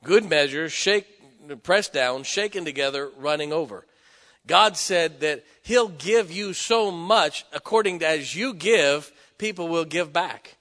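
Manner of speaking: slow, loud, high pulpit delivery; full-force preaching. Acted. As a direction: thunder the verse out slowly to the congregation. Preacher quoting scripture loudly.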